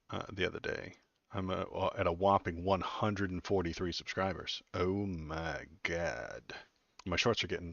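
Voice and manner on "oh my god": slow, drawn-out mock amazement; sarcastic awe. Real, not acted. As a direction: stretch it out sarcastically.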